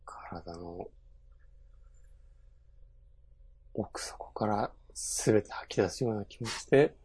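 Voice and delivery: slow whispered meditation voice; guided meditation. Pretend. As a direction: breathe them in and out, hushed. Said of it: "それ絶対スピリチュアルなやつでしょ".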